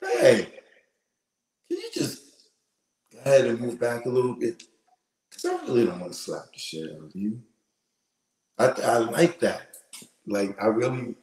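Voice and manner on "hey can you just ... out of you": sweet-voiced threat. Shown as a demonstration. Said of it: "I know I can just".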